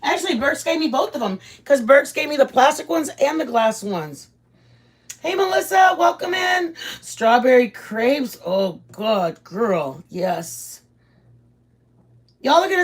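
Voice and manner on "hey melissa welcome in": calling out a greeting. Real, not acted. Greeting a viewer on stream.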